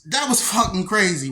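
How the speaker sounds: normal talking voice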